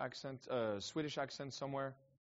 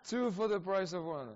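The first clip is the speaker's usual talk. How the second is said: a bit higher and louder, still excited; amused repeat; 6 semitones higher, 5 dB louder than usual. Real, not acted. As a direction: echo the joke with a grin. Repeating the joke.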